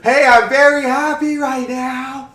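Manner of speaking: huge high drawn-out holler; mock all-caps shouting. Joking about what caps lock means, exaggerated happy yell.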